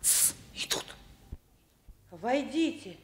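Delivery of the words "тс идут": hushed warning whisper. Acted. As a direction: whisper it under your breath. Hushing as visitors arrive.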